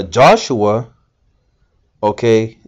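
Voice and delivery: ordinary talk, nothing marked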